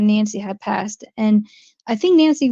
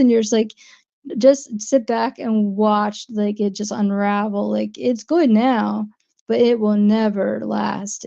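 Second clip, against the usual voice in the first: ordinary talk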